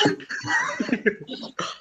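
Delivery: very high squeal of laughter; laughing out of character. Real, not acted. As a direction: crack up mid-scene. Laughs at slipping accent.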